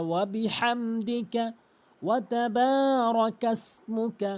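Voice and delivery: very slow Qur'anic-style recitation, long vowels and held hums; full melodic recitation. Shown as a demonstration. Teacher reciting the whole Sana once, slow melodic recitation. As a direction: recite it through evenly, drawing out every long syllable. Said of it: "শুরু থেকে নিয়ে শেষ পর্যন্ত একবার পড়ছি".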